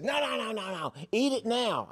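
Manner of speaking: put-on the kid won't take no for an answer; insistent kid. Teen kid shouting at the pastor to eat it now, high and insistent.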